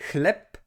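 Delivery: "chleb" ending in a voiceless p; final devoicing. Shown as a demonstration. Teacher applying the rule to Polish bread, devoiced ending. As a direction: say "chleb" as khlep. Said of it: "pronounced with the voiceless counterpart".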